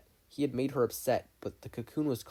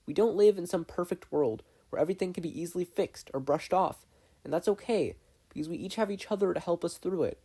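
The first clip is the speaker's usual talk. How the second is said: put-on gentle girl voice; 7 semitones higher, 3 dB louder than usual. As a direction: reassure him like a caring sister. Mabel comforts her brother.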